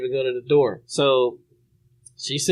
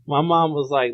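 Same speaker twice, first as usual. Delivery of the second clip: ordinary delivery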